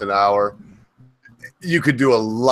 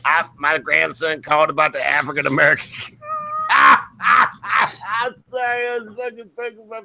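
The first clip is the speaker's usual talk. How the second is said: put-on old lady voice; 8 semitones higher than usual.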